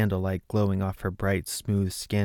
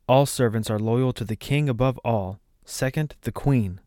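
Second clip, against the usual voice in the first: plain reading voice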